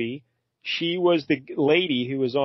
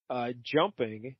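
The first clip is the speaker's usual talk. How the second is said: normal talking voice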